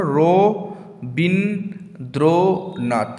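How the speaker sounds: "Rabindranath" very slowly, four syllables with long pauses; teacher spacing out syllables to count. Shown as a demonstration. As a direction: stop after each of র, বিন, দ্র, নাথ in "Rabindranath". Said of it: "চারটি অক্ষর".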